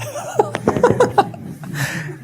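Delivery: soft, high, breathy giggle; amused laugh. Real, not acted. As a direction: let a light laugh trail out. Amused at his own anecdote.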